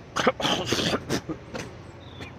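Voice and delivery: sudden choking cough; coughing fit. Real, not acted. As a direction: cough out the swallowed bug. Swallowed a gnat while walking.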